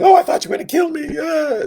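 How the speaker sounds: high, loud, fast hammed-up wail; mock wailing. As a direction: wail it out like a terrified brother, mock-serious. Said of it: "And Jacob's crying".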